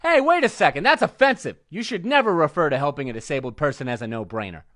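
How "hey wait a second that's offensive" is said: mock offended. Feigns offence at own joke.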